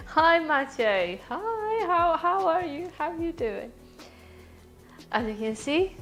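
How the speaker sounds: normal talking voice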